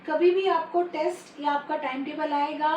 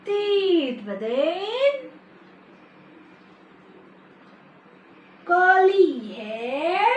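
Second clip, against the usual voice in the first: very slow sing-song rhyme; nursery rhyme recital; much slower than usual. Acted. Teacher reciting Chubby Cheeks with kids, slow sing-song. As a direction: draw out each line for small children.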